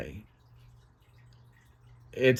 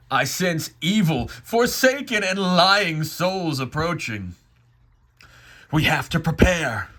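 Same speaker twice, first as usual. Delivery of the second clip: raised, dramatic call to prepare; commanding God; 5 semitones higher, 3 dB louder than usual. Acted. God warns of evil souls approaching, commanding. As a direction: sound the alarm in a higher voice.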